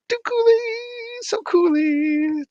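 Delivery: very high, slow cutesy voice; singsong greeting. Acted. Streamer coos a greeting to a viewer. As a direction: coo it like baby talk.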